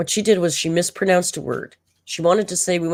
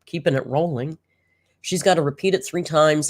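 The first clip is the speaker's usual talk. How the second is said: usual speaking voice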